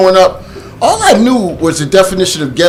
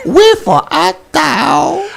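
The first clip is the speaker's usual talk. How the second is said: slow, higher, theatrical "Wherefore art thou?"; mock Shakespeare line; 9 semitones higher, slower than usual. Host teasing the actor with a hammed-up Shakespeare line. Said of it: "can you do Shakespeare".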